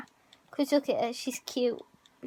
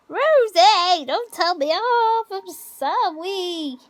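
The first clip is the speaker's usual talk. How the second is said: mock apology; 5 semitones higher, 6 dB louder, much slower than usual. Real, not acted. Pleading with budgie, mock.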